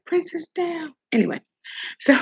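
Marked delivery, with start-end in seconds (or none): 0.1-0.9 higher, softer level call; mock announcement of a fall, higher pitched. Telling of her own fall, mock announcement. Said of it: "So I was like".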